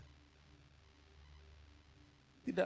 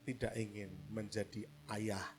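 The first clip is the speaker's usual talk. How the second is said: sincere reflection; 4 semitones lower, 5 dB quieter than usual. Real, not acted. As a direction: read it like a quiet confession. Reads a quoted sentence softly. (renungan tulus, pelan dan lembut)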